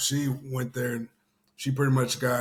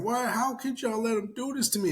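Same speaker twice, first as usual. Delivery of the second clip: high, tearful mock plea; a retold distraught woman begging, much higher; crying plea; 11 semitones higher than usual. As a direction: imitate her wailing at the men, pitched way up. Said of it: "she's crying and saying".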